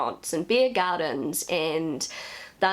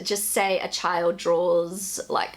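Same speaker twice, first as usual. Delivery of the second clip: normal talking voice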